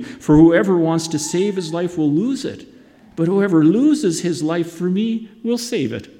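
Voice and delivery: normal talking voice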